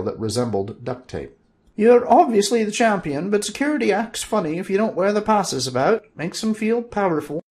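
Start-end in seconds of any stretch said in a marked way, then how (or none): 1.8-7.4 put-on high, louder old wizard voice; Fezzer's voice. Fezzer explaining passes to Bob, high wizard voice.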